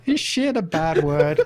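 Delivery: giggling through the quote; laughing quote. Retold or imitated. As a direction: laugh while repeating the kid's line. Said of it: "laughing while quoting".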